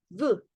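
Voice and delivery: V as a brief syllable; short sound. Shown as a demonstration. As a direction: keep the "v" very short. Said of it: "y un sonido corto". ("v" con sonido corto)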